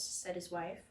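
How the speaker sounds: ordinary delivery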